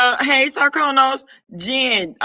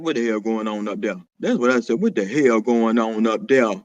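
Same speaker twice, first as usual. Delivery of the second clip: much lower, booming-down deep register; 9 semitones lower than usual. Acted. Scolding the people acting a fool up there, deep voice. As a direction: drop way down into your deep voice. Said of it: "Y'all know my voice said".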